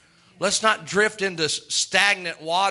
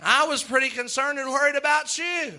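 teasing holler; 8 semitones higher than usual. Pretend. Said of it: "down the table hollers at you".

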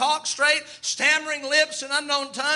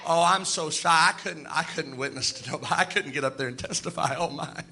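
lower, mock-timid shy believer; 7 semitones lower, 3 dB quieter than usual. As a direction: whimper it like someone too shy to testify. Mocking a shy churchgoer.

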